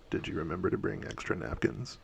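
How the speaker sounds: low, soft calm menace; vampire about to feed, quietly menacing; quiet creepy question. Acted. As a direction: murmur it softly like a hungry vampire. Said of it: "He says".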